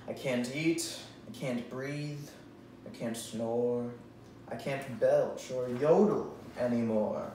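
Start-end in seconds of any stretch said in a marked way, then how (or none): none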